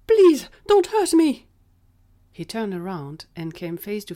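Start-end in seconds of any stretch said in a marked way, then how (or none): 0.1-1.5 loud, high panicked plea; terrified scream. A narrator's reading. Gary grabbed from behind by Allie, screaming in terror. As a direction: beg at the top of your voice, scared. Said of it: "making him scream in terror".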